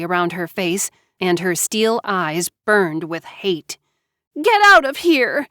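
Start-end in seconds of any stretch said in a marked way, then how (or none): 4.4-5.5 loud, high hysterical yell; hysterical scream. A narrator's reading. Loud high hysterical shout. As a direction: Joanna screaming at her sister to leave. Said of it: "Joanna screamed hysterically".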